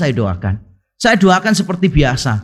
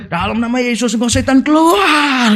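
raised pitch, stretched last word, no real volume; imitated exorcist cry; 5 semitones higher than usual. Friend driving out a demon, mock yell climbing in pitch. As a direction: mimic a shouting exorcist, voice climbing. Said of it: "teriaknya kencangnya setengah mati".

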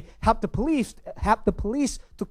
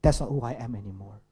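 self-talk; 9 semitones lower, 5 dB quieter, faster than usual. Shown as a demonstration. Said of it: "is simply by saying".

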